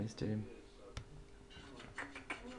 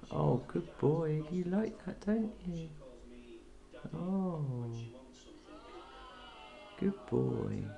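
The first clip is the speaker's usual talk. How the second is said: soft, higher, slow praise for a pet; tender cooing; 4 semitones higher, 7 dB quieter, much slower than usual. Real, not acted. Owner praising a parrot during a head rub, tender cooing. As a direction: coo softly as you stroke an animal.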